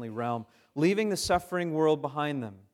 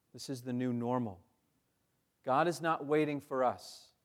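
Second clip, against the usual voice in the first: normal talking voice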